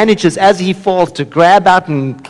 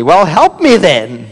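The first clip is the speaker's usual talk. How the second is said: high, loud, drawn-out plea; acted desperate cry for help; somewhat hysterical; 4 semitones higher, 6 dB louder than usual. A narrator's reading. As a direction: plead in comic panic. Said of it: "somewhat hysterically".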